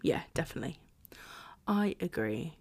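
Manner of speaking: soft unvoiced whisper; hushed aside; whispered agreement. Real, not acted.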